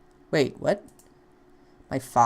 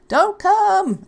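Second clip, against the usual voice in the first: high, loud panicked scream; 13 semitones higher, 8 dB louder than usual. Acted. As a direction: scream him away in panic. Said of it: "I screamed".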